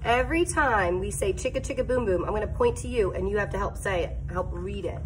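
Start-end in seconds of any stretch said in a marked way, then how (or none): none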